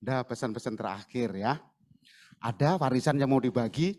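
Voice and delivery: low, soft, casual mock question; mock visitor. Preacher mimicking a tactless visitor, softly casual. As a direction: ask about the inheritance like a tactless visitor.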